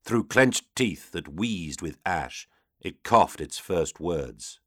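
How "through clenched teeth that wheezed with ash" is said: ordinary delivery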